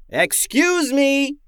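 high, slow, drawn-out call for attention; a loud, polite wake-up call to sleepers; said loudly. A narrator's reading. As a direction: Tim loudly waking the sleeping brothers. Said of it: "Tim said loudly".

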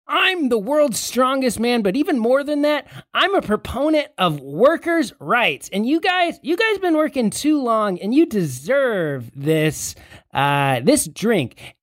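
high, emphatic rallying speech; hammy strongman pitch. Acted. Disguised strongman winning over the guards, high proclaiming voice.

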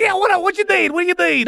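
high, pressing voice; impatient spirit. Acted. Spirit asks Albie for the request, impatiently.

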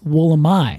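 "Wollemi" ending in "my"; Australian pronunciation. Shown as a demonstration. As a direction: demonstrate "Wollemi" with a final "eye" sound. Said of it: "which they pronounce".

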